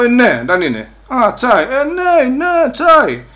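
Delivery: high, loud eager cry of tea; excited yes tea. Retold or imitated. He shouts to police that it's just tea.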